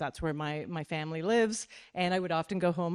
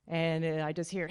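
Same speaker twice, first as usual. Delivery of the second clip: ordinary talk, nothing marked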